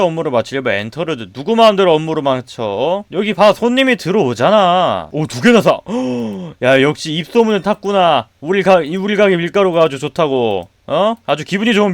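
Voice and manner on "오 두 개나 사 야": usual speaking voice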